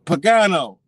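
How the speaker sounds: insistent call to get attention. Retold or imitated. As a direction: call the surname out so it carries. Calls name again after a "Huh?.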